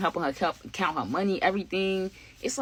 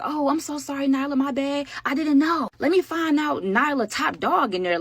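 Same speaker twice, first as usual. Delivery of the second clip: higher, a touch frightened, backing off; scared apology; 7 semitones higher than usual. Retold or imitated. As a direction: squeak out the apology to the top dog. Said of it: "the other girl seemed scared".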